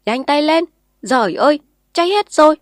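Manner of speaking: put-on old man yelling in alarm; shouting very loud. A narrator's reading. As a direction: bellow orders over the blaze. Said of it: "Lão Bòng hét rất to".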